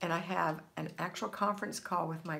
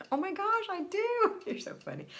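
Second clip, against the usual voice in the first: imitating her friend; 13 semitones higher than usual. Retelling her friend's delighted high reply. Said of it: "she says".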